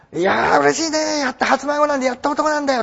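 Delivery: half-playful happy exclaim; mock delighted grandpa. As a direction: burst with lightly hammed-up joy at a first grandson. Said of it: "って言えないです".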